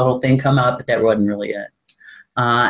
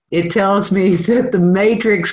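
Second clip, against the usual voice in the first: high, slowed words breaking into laughter; amused, tipping into a big laugh; 8 semitones higher, slower than usual. Real, not acted. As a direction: answer through rising giggles. Jokes about alarm, bursts out laughing.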